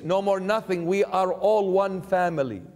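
normal talking voice

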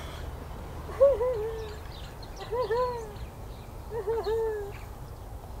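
high mock wailing sobs; hammed-up boo-hoo crying; fake crying. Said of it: "we're gonna pretend to be very sad".

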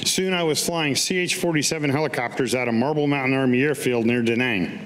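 ordinary delivery

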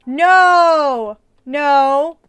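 loud panicked no; loud protest. Real, not acted. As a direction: cry out to stop it. Friend hunting her.

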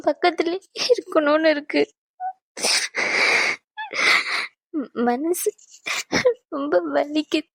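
crying, halting plea; tearful plea to stay close to him. Acted. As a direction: beg him through tears. She begs him through tears.

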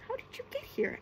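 high-pitched scallywag question; squeaked-up storybook scallywag. A narrator's reading. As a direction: put on a high little creature voice. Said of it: "asked the scallywag voice".

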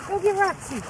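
normal talking voice

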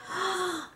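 put-on soft childlike gasp of wonder; quick, quiet intake of breath; mock excited gasp. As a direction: gasp like a thrilled little kid. Imitating eager children in the show.

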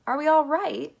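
high, loud worried question; anxious cry. A narrator's reading. Grandpa Joe hanging high in midair, anxious cry. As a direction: cry out for reassurance. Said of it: "cried Grandpa Joe".

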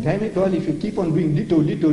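ordinary talk

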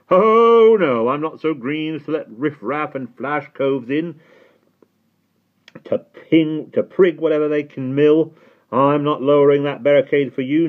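high, loud cockney porter; loud jeering gatekeeper voice; scornful gatekeeper. A narrator's reading. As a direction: refuse the boy with contempt. Gatekeeper refuses Simon entry.

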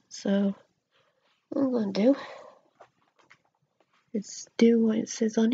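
lips stiff under mask; talking with restricted mouth; through a sheet face mask. Real, not acted. Seen 1: Wet sheet mask covers whole face around lips throughout labelled words.

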